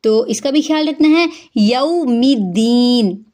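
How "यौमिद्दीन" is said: यौमिद्दीन slowly with a doubled द; correct recitation. Shown as a demonstration. Teacher recites यौमिद्दीन, slow and careful. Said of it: "ठीक pronunciation है".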